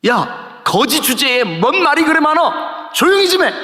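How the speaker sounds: high-pitched mock telling-off; playing the crowd shushing a beggar; acted scolding. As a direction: climb sharply into an exasperated rebuke. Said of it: "꾸짖었어요".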